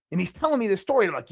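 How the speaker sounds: ordinary delivery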